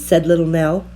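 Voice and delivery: ordinary delivery, nothing marked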